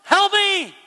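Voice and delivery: very loud, high cry for help; desperate prayer shout. Shown as a demonstration. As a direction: yell it to God, drawing out the plea. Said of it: "Mine's usually this".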